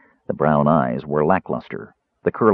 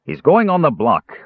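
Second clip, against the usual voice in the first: raised, forceful declaration; loud and firm; 10 semitones higher, 4 dB louder than usual. A narrator's reading. Bearskin man ignoring the jailer, loudly insistent. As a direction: cut in firmly, voice raised. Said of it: "the bearskin man said loudly".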